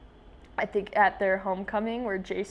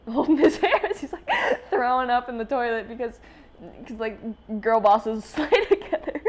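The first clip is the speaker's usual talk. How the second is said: laughing; 3 semitones higher, 4 dB louder than usual. Real, not acted. Laughing through her story.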